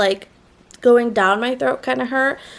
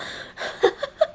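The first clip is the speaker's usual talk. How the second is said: breathy laugh; 5 dB quieter than usual. Real, not acted. Laughing at the hospital snapshot.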